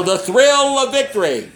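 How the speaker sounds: high, drawn-out mock triumph; hammed-up sportscast intro, soaring in pitch; TV sports announcer. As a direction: sing out the triumph high and slow. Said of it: "So he'd go".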